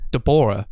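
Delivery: Deborah as de-BOR-ah; mispronounced name. Acted. Actor misreading the script's name, Deborah mispronounced as de-BOR-ah. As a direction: land on the middle syllable of Deborah, like "BOR". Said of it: "it's not pronounced that way".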